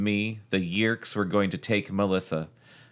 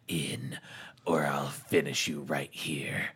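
low, much softer menacing hush; cruel whisper; 6 semitones lower, 7 dB quieter than usual. A narrator's reading. Chapman threatening the cat at the cage, low cruel hush. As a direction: threaten it close and quiet. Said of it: "he said in a cruel whisper".

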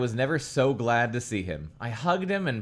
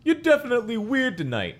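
put-on high quirky kid voice; character voice; 12 semitones higher, 4 dB louder than usual. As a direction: pitch it up as the kid. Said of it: "What was that voice?".

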